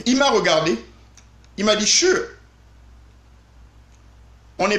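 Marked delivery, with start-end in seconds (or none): 1.9-2.2 cheerful sure. Retold or imitated.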